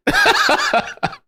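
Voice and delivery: high-pitched burst of laughter; laughing. Real, not acted. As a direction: burst out laughing at your own hint. Laughs at own hinted remark.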